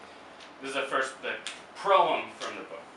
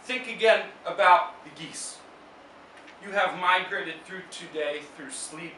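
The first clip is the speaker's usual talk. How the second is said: slow, raised, intoned poetry reading; solemn poet's recital, higher and slower; poem reading; 6 semitones higher, slower than usual. Acted. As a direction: read each line like a spell, with long pauses. Reads second poem aloud.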